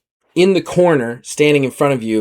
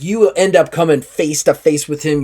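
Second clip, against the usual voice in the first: ordinary delivery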